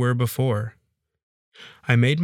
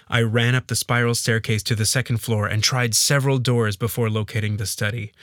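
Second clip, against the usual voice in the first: plain reading voice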